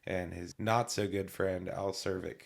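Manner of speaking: ordinary talk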